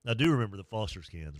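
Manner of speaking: ordinary talk, nothing marked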